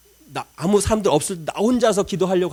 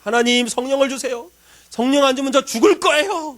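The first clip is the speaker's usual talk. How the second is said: high, loud threatening plea to God; desperate plea; 7 semitones higher, 5 dB louder than usual. Retold or imitated. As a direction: beg like your life depends on it. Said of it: "막 그러면서 위협도 하면서". (절박하게 위협하듯 외치는 기도)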